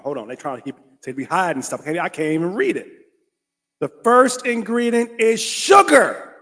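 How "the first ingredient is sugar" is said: high, loud, slow outrage; indignant reveal. Real, not acted. Vlogger exposing the coffee label, slow loud outrage. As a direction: drag it out in disbelief.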